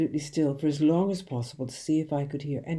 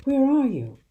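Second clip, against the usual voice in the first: high, loud call ending on the question; shouting; 6 semitones higher, 4 dB louder than usual. A narrator's reading. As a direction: shout out, searching. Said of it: "I shouted".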